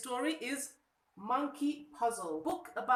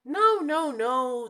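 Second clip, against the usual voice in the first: loud protest; 3 semitones higher, 10 dB louder, slower than usual. Acted. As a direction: shout the denial. Monkey rejects frog.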